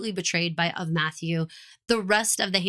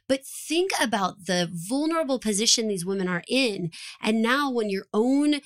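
ordinary talk

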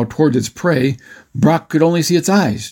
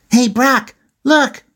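put-on high-pitched boyish holler; acted high calling-out; excited holler; 12 semitones higher, slower than usual. A narrator's reading. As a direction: call your brother to look, voice up high. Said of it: "Matt hollered".

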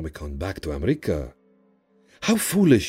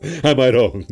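gleeful djinn repeating his question, raised voice; laughing djinn; 4 semitones higher, 4 dB louder, slower than usual. Acted. Djinn teasing Frank about his weakness, gleeful. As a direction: crow it as an amused djinn. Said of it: "laughing and slapping me on the shoulder".

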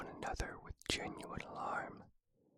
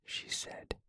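plain reading voice

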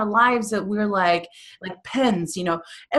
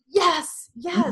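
excited yes; 9 semitones higher than usual. Retold or imitated. Celebrating when something good happens.

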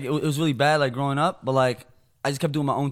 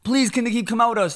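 higher-pitched retold plea; begging; 5 semitones higher than usual. Boy asking friend's mom to let him out, begging. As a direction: beg a parent for a small favor. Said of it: "I used to beg his mom".

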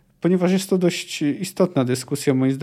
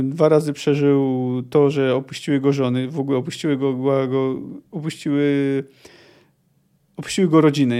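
normal talking voice

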